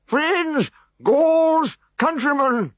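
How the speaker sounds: high, loud orator's opening; high booming speech opening; resounding. A narrator's reading. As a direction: address the crowd grandly. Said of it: "he resoundingly began".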